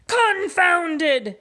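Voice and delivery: pirate shout. Acted. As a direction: roar it like an outraged captain. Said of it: "shouted Pete".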